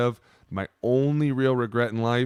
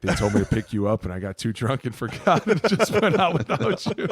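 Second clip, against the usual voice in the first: laughing confession; 4 semitones higher, 5 dB louder than usual. Retold or imitated. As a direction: voice climbing high through laughter. Retold confession, cracking up.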